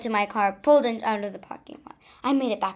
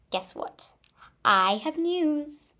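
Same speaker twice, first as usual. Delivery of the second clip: squealing; 5 semitones higher, slower than usual. Acted. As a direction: whisper then high slow squeal. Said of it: "squealed Diane".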